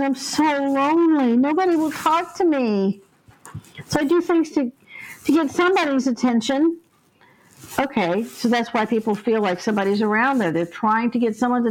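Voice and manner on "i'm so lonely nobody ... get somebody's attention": put-on lonely little girl. Lonely ghost girl explains.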